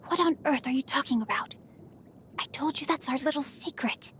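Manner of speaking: hushed and quick, keeping a secret; a worried half-whisper. Acted. Hushing a secret from listener.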